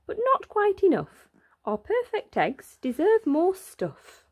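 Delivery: high, eager bird voices; greedy excited magpies. A narrator's reading. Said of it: "they said".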